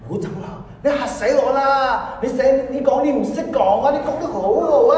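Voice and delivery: mock-astonished Cantonese elder; astonished church elder. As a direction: gush in disbelief in Cantonese. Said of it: "后来居然会跑上去".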